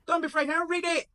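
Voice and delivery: high, loud command; high-pitched bossy urging; impatient Michael. Acted. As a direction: push him to read, high and sharp. Michael orders Jesus to read.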